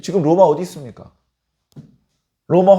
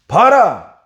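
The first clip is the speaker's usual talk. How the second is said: loud, higher mocking call to look; mocking proclamation; 5 semitones higher, 6 dB louder, much slower than usual. Pilate shows the crowd their king, loud and mocking. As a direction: call out as the taunting governor. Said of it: "비꼬는 듯한 그런 표현을".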